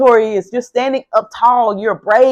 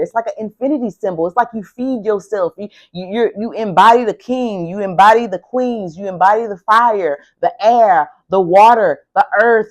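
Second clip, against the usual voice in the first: ordinary talk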